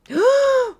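loud, high gasp; shocked gasp. Real, not acted. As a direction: gasp sharply at a sudden shock. Sees fire in the game.

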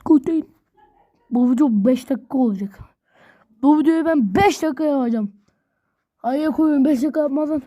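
ordinary delivery

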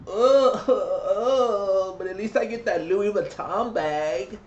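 high put-on voice, hammed-up grunting; mock a gold digger faking pleasure. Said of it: "and you're like".

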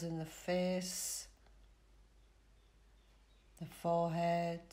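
calm relaxation guide with long pauses; soothing Yoga Nidra voice, spaced phrase by phrase; guided meditation. Real, not acted. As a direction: lead them gently into rest, unhurried.

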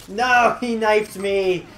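slow drawn-out loud no; outraged shock. Real, not acted. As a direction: cry out in shocked dismay. Knifed by enemy.

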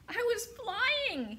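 high, slow squeaky gosling voice; gosling squeak. A narrator's reading. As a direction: squeak it out like a baby bird. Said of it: "he squeaked".

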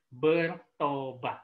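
"Bertobat" drawn out slowly and softly; slow quiet appeal. Shown as a demonstration. Pastor answering the heartbroken girl, slow and soft. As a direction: let "Bertobat" hang as a gentle plea.

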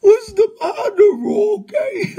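high, loud whiny taunting voice; mock baby taunt. Taunting Rocky about crying, in high whine. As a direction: coo at him like a crybaby.

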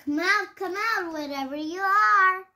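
high, loud hide-and-seek call; loud sing-song calling. Acted. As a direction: call out like seeking a hiding kid. Babysitter searches for hiding child.

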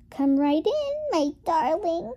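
lilting grandmotherly invitation to enter; calling out. A narrator's reading. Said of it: "called the grandmother".

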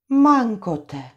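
a startled cry. Acted. Anče cries out after the blast, startled cry. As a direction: exclaim in shock. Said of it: "vykřikla Anče".

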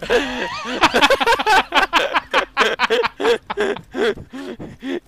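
long, high laughing fit; real wheezing giggle fit; helpless laughter. Real, not acted. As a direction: crack up uncontrollably. Cracking up at friend's rant.

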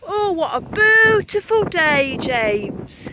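slow, high, loud rapture over the day; marvelling at a beautiful day; loud delight. Real, not acted. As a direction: throw the joy out into the wind. Rapture at the weather.

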